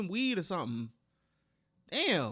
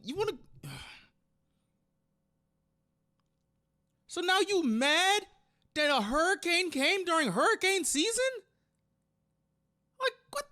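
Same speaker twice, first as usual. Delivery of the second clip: outraged rant; 7 semitones higher than usual. Real, not acted. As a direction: go off on her, voice climbing in outrage. Outraged at girlfriend's vacation demand.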